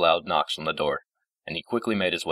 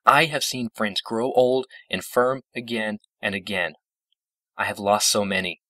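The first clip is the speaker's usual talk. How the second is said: higher, forceful lament; impassioned outburst; 5 semitones higher, 3 dB louder than usual. A narrator's reading. Celestia on outliving all her friends, impassioned and lifted. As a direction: pour out long-held grief, emphatically.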